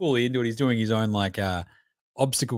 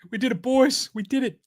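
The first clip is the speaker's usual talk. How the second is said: jubilant; 12 semitones higher than usual. Real, not acted. Celebrating again.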